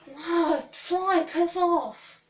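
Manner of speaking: real irritation breaking into the story; annoyed at a fly. Real, not acted. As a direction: shoo the fly away, irritated. Swatting at a fly mid-story.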